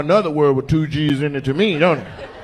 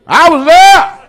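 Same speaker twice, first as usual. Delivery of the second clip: put-on high, loud old-timer boast; old man catchphrase; 13 semitones higher, 10 dB louder, slower than usual. Old man bragging to the crowd, high and loud. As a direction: shout it like a proud old geezer.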